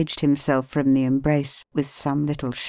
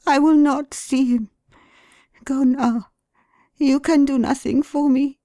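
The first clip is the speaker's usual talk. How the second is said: high, louder wife voice, refusing; a wronged wife demanding her child, high; high, insistent voice of a hurt wife; wife's distraught refusal; 12 semitones higher, 5 dB louder than usual. A narrator's reading.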